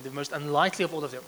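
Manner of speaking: ordinary talk, nothing marked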